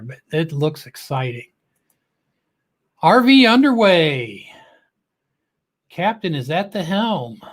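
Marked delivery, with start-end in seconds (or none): 3.0-4.5 slow, louder and higher name shout-out; drawn-out welcome call; announcer call-out. Acted. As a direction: announce the channel like a host. Host calls out viewer's channel name.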